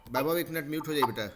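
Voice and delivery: ordinary talk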